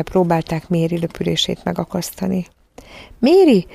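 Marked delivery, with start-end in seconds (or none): 3.2-3.7 high, loud call into the distance. A narrator's reading. Children call from the window to departing Mary, pleading shout. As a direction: shout the name after someone leaving. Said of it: "kiáltották".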